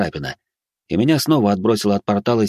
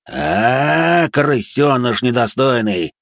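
mocking demon; 4 semitones higher, much slower than usual. A narrator's reading. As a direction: sneer and spit the insults. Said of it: "рассмеялся он".

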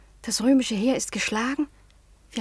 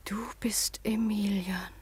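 slow, soft whisper of disbelief; stunned whisper; 9 dB quieter, slower than usual. Acted. Daughter realizes her fiancé has returned, a slow stunned whisper. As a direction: whisper it as if unable to believe it.

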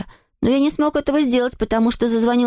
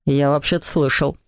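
low-pitched dry dad; father's voice; 8 semitones lower than usual. A narrator's reading. Papa answers his son at the table, low fatherly voice. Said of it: "сказал папа".

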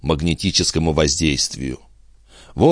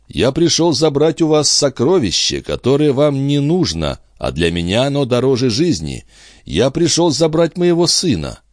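put-on raised-pitch, urgent father demanding his child; impassioned father; 8 semitones higher than usual. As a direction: plead insistently, voice lifted. Жильбер требует вернуть сына. (взволнованный отец, настойчиво и повышенным тоном)

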